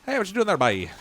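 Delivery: jokey Newfie impression, "bye" on the end; hammed-up Newfoundland accent. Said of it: "Oh, yeah, the Newfies".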